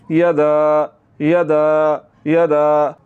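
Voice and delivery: "यदा" with a short "ya" and long-held "daa"; lengthened daal. Shown as a demonstration. Teacher shows the madd, stretched final vowel in यदा. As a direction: hold the last vowel of "यदा". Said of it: "दाल को लंबा करना है".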